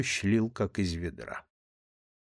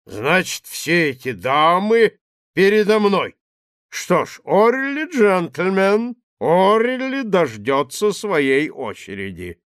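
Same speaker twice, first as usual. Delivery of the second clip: put-on drunken swagger; 6 semitones higher, 6 dB louder than usual. Drunk man barges in.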